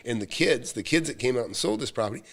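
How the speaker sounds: ordinary delivery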